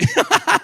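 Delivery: a loud, high laugh; high burst of laughter. Real, not acted. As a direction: laugh out freely. Riso ao lembrar a cena. (risada alta e aguda)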